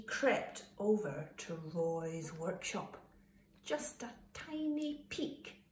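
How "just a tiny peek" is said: high, soft, slow sneaky whisper. A narrator's reading. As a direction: whisper it furtively, drawing it out. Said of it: "he whispered".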